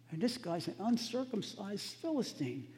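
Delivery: high, loud, impassioned preaching, slowing on the key phrase; the preacher building to an indignant climax; preaching at full force. Acted. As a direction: drive it home from the pulpit. Preaching climax, rising pitch and volume.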